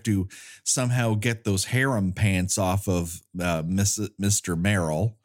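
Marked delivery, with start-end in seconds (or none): none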